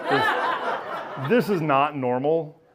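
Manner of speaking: high laugh breaking into a flustered excuse. Retold or imitated. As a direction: laugh through the embarrassed excuse.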